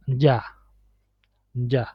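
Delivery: "ja" starting like English J; soft, low "ja" with a J-like Spanish Y. Shown as a demonstration. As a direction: say "ja" with a sound close to English J. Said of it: "It's similar to the J in".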